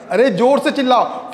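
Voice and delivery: usual speaking voice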